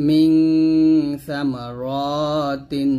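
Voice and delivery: long hummed ng on "মিং"; nasal ghunnah. Shown as a demonstration. Teacher reciting an example for learners, "মিং" held through the nose. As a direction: hold the nasal of "মিং", then slowly finish "সামা র তিন". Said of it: "অনুচ্ছেদের মতো করে উচ্চারণ করতে হবে".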